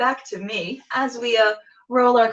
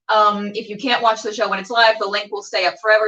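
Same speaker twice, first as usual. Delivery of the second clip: ordinary talk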